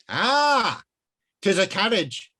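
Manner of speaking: high, loud archaic wizard. Acted. Wizard explaining cars to himself, high and archaic. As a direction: pronounce it like a medieval sage.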